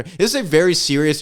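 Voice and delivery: usual speaking voice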